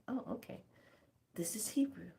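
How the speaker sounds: hushed whispered aside. Retold or imitated. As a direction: whisper it like a secret. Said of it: "And I was like".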